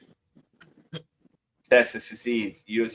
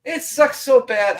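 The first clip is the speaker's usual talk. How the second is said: high, slow, drawn-out complaint; pitched-up whine of regret; whiny lament; 12 semitones higher, 7 dB louder, slower than usual. Real, not acted. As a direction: moan it like it truly hurts. Lamenting about California.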